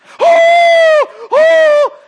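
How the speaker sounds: very high, loud, drawn-out "hoh!"; imitated mom's piercing cry of shock; shocked whoop. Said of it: "she's just shocked".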